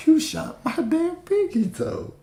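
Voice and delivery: very high, slow, outraged squeal; movie victim voice. Retold or imitated. Shot man yelling at the shooter, high outraged squeal. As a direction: squeal it like the man who just lost his toe.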